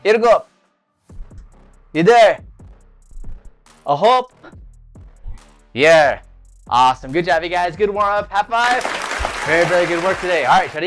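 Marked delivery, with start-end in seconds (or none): 0.1-6.2 shouted count. Shown as a demonstration. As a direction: call the numbers out. Shouted count during lunges.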